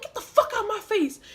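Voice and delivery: high-pitched re-enacted demand; acted-out confrontational outburst; angry retort. Acted quarrel with big guy.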